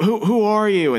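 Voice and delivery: high-pitched, stammered retold question; startled questioning. Brother confronts a stranger in the woods, startled questioning. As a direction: imitate someone taken aback.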